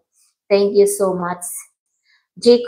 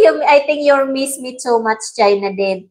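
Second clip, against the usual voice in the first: usual speaking voice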